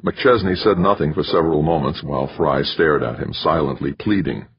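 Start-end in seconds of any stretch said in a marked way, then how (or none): none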